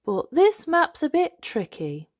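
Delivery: a lightly voiced confused father; Daddy Pig voice. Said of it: "says Daddy Pig".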